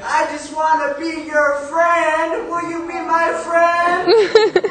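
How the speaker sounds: high, loud and slow, begging to be friends; a small boy pleading with the giant; eager little boy. Acted. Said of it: "And the young boy said".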